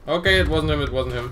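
loud and urgent; excited. Real, not acted. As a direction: blurt it out in alarm. Under fire.

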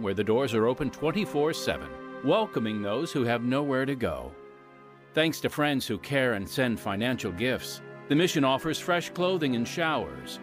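ordinary talk

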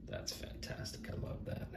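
hushed, unvoiced-breath whisper of amazement; awed whisper. Real, not acted. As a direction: whisper it as if stunned by a gift. Awed at the underwater creature.

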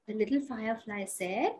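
ordinary delivery

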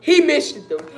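loud, high cry of disbelief; exasperated outburst. Real, not acted. Fan reacting to a missed free throw, exasperated. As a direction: burst out in real frustration.

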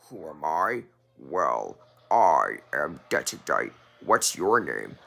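low, measured self-introduction; Dark-world knight. Acted. Knight's dark double introduces himself, low and composed.

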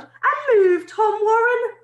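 high, loud headmaster's yell. Acted. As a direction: bellow at the late boys across the yard. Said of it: "he yelled".

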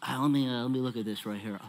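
low, quiet, fussy expert peering closely; antique appraiser voice. Retold or imitated. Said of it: "they're like".

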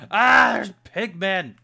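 high, very loud, slow startled cry; alarmed cry. Real, not acted. Player spotting pigmen, crying out in alarm. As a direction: call it out in alarm.

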